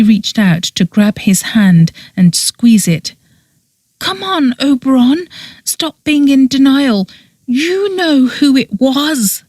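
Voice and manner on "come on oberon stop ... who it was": high, pleading, close to crying, slowing at the end; near tears. A narrator's reading. Petra begging Oberon to stop denying, near tears. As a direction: beg him to face it, voice on the edge of tears. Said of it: "looked like she was going to start crying".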